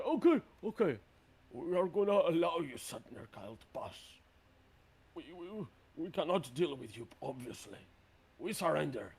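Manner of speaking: mildly flustered giant giving in; nervous surrender. Acted. Game master as cowed giants yielding to the hero, nervous surrender.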